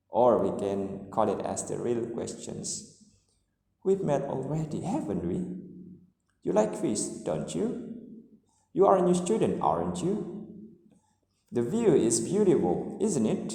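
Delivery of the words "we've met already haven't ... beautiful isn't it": checking tags that climb, asking for an answer; uncertain rising tags. Shown as a demonstration. Teacher models unsure tag questions, rising at the end. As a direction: lift "haven't we", "don't you", "aren't you", "isn't it" at the end. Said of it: "question tag that show uncertainty".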